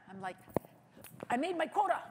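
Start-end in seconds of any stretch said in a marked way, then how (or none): 1.3-2.0 higher, bright and proud; triumphant. Retold or imitated. The speaker boasting about hitting the fundraising quota, higher and triumphant. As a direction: announce it like a small victory.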